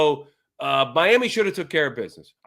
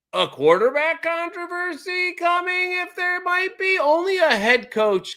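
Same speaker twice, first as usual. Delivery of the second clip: mock dramatic disbelief; 12 semitones higher than usual. Mocking the quarterback situation.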